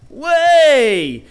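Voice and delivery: loud, high, drawn-out cry of disbelief; shocked disbelief. Shown as a demonstration. Preacher demonstrating the word as an exclamation, shocked disbelief. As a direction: burst out in amazed unbelief. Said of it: "kind of as a, an expression of shock".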